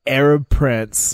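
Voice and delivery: slow, spaced-out mock headline; headline read. Co-host inventing a scandalous headline, slow headline read. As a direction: announce it word by word like a tabloid headline.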